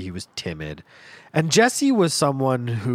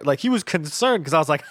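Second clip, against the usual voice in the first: normal talking voice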